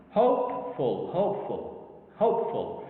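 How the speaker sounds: "Hopeful" repeated with HOPE dominant; stress on first syllable. Shown as a demonstration. Teacher showing how the word is pronounced, "hopeful" with HOPE stressed. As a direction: punch HOPE, let "ful" fall away. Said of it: "listen to how this word is pronounced".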